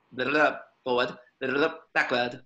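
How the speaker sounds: ordinary talk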